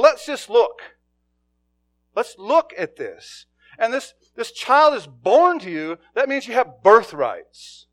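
high, loud preacher's call; preaching at full force. Acted. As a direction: lift the voice and preach to the room.

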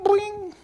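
high, bright imitated phone ring "bling"; phone ring imitation. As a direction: chirp it like an old phone ringing. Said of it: "The phone used to ring like that".

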